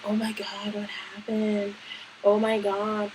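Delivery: very soft, imitated worried murmur; hushed concern. Random girls consoling the crying teller, very soft concern. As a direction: play a concerned bystander, barely voiced.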